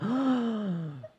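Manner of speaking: sharp high gasp of delight. Real, not acted. Streamer finding the Fontaine teapot, delighted gasp. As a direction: suck in air, thrilled.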